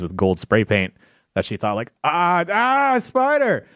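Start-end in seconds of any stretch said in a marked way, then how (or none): 2.0-3.7 high startled yelp at a spider; startled shriek. Retold or imitated. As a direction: shriek it like you just saw a spider. Friend startled by spider, quoted.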